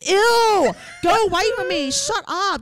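high, loud grossed-out yell; disgusted shout. Retold or imitated. Brushing off people who call her teammate hot, loud disgusted yell.

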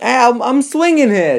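high, loud New York shout; New York accent. Pretend. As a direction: yell it like a Brooklyn cabbie. Riffing on the movie line.

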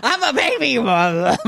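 put-on laughing through an old-man line; laughing impression. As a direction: crack up mid impression. Imitating Biden while laughing.

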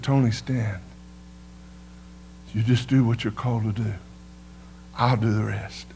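low, soft and steady voice of God; a hint of a calm divine reply; lightly voiced God. God answering fearful Tony, low gentle reassurance. God's voice quoted, lower, softer.

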